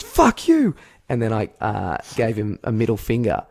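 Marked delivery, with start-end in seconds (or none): 0.0-0.8 high, louder yelled comeback; retold angry shout. Driver returns the insult, angry high shout. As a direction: fire it back high and loud.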